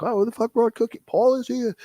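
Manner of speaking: high, fast, put-on chef voice; excited chef. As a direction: blurt it out in delighted surprise. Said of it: "the chef be in the back, like".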